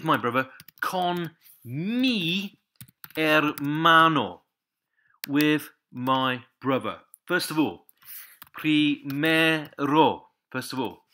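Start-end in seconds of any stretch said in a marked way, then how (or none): 0.8-4.4 Con mi hermano drawn out, higher pitched; slow phrase model. Shown as a demonstration. Teacher models the phrase slowly. 8.5-10.3 primero very slow and louder; slow word model. Shown as a demonstration. Teacher models the word slowly.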